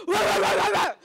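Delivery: loud, high "rah rah rah" yelling; wordless shouted gibberish; garbled shouting noise. Acted. What the dog hears from dad, loud garbled yelling. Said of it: "all my dog hears is".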